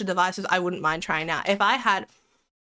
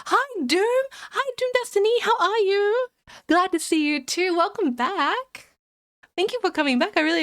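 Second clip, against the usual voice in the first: excited greeting; 11 semitones higher than usual. Real, not acted. Streamer welcoming Doom Destiny back, excited greeting. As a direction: sing out a sweet hello to a returning friend.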